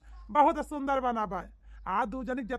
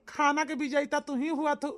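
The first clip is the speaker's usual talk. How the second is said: usual speaking voice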